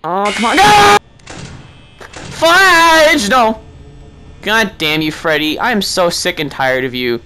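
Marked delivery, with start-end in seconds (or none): 0.6-3.6 high, loud, stretched cry; frustrated yell. Real, not acted. Player killed by Freddy, frustrated yell. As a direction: yell out at losing.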